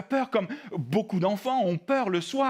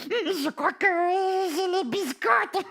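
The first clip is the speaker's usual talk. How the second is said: high, drawn-out puppet wail; fearful puppet confession; 9 semitones higher, much slower than usual. Acted. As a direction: stretch it out as a scared puppet. Puppet confesses its fear, wrong word.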